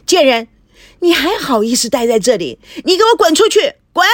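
high, loud screaming rage; wife driving the mistress out, screaming; furious shout. Acted. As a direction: scream her out of the room.

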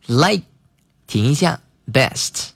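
Like, then a clear pause, then best; Like and best split by a pause; pause between words. Shown as a demonstration. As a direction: stop after Like before saying best.

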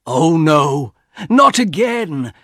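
rising from dread to a drawn-out cry; alarmed cry. A narrator's reading. Husband dreads another wish, crying out in alarm. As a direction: protest in horror, pitch climbing.

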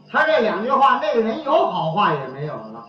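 ordinary talk

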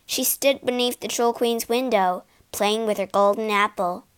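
ordinary delivery, nothing marked

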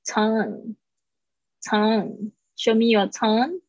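slow "tongue" ending on "ng", silent "ue". Shown as a demonstration. Teacher models for students, slow careful "tongue". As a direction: stretch "tongue", closing on the back-of-tongue nasal. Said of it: "就是不发音了".

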